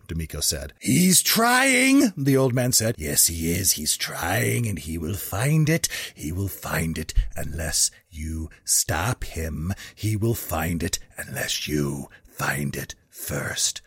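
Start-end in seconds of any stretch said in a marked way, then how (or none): 0.8-2.1 high, loud, stretched-out warning; old man's cry. A narrator's reading. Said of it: "the old man shouted, his voice creaking". 3.0-13.8 low, slow prophetic warning; ominous old man. A narrator's reading. Old man's prophecy in story.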